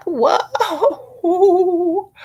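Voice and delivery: high, delighted whoa with a laugh; delighted laughing whoa, high and rising. Real, not acted. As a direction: gasp it out in amused wonder. Amazed at her own artwork.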